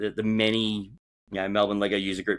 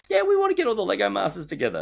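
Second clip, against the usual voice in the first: high-pitched excited pitch; imitated enthusiasm, well above usual pitch; eager benefactor; 12 semitones higher, 3 dB louder than usual. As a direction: announce the plan like a keen backer. Voicing an imagined eager philanthropist.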